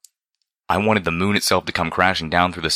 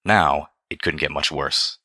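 plain reading voice

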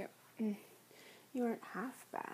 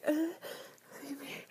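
whispered plea; 6 semitones higher than usual. Acted. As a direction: whisper feed me, begging. Whispered take of repeated plea.

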